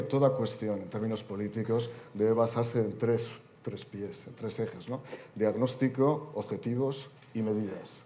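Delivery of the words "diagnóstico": ordinary talk, nothing marked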